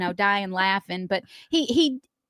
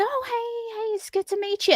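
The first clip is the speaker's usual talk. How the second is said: high, soft hello to a newcomer; sweet, high-pitched reenacted welcome; retold friendly greeting; 11 semitones higher, 5 dB quieter than usual. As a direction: greet a nervous guest gently, pitched way up. Retelling her greeting to nervous girlfriend.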